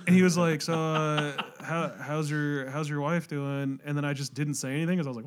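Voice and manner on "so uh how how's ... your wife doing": slow, halting, groggy question; mock heavily medicated small talk; imitating drugged-up dad. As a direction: grope slowly for each word, as if drugged. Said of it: "he was drugged up super bad".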